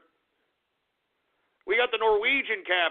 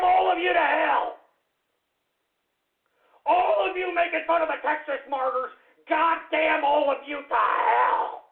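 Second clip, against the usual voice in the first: furious shout; 7 semitones higher than usual. Acted. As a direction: curse the whole chat to hell at full voice. Theatrical rage about the Texas martyrs.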